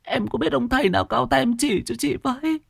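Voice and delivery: very high, quavering wail of fear; panicky, tearful-sounding begging for help; terrified insistence. Acted. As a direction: plead desperately, still haunted by the night. Terrified boss insists ghost is real.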